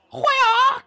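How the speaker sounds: high sharp martial arts shout; hammed-up kung fu battle cry. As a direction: yell it like a kung fu fighter. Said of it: "like, Kung Fu style".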